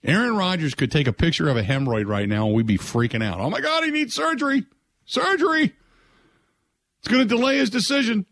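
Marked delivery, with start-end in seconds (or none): 3.4-8.2 high, breathless mock fan panic; pitched-up parody of panicking fans. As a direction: ham up the overblown fan freakout. Mock fan panic over Rodgers.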